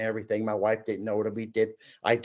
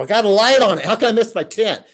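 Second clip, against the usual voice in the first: exasperated; 9 semitones higher, 9 dB louder, faster than usual. Retold or imitated. Can't find his tent.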